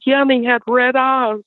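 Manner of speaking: high, imitating a scared boy; frightened son. Son telling mom what they saw, high and scared. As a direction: tell it like a shaken child. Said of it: "He said".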